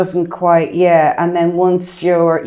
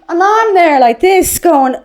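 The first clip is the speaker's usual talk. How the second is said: mock exasperation; 9 semitones higher, 6 dB louder, slower than usual. Building into her own acted despair.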